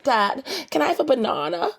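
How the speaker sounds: high-pitched Australian "banana", as "ba-NAH-na"; child's Australian accent. Retold or imitated. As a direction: imitate a little girl asking in an Australian accent. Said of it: "And she'll be like".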